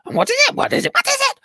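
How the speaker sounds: high, loud squawking question; eager parrots crowding in; high parrot chorus; parrot voices. Acted. Parrots demand the message.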